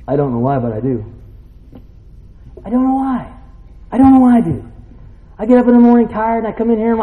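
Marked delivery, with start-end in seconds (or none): 2.6-7.0 high, insistent preacher's voice; fired-up preaching. Real, not acted. Preacher coming in tired yet eager, fired up. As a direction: testify with raised pitch and urgency.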